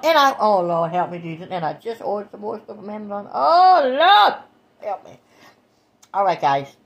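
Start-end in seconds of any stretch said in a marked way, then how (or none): 3.3-5.2 mock despair. Real, not acted. Mock despair over Amazon shopping.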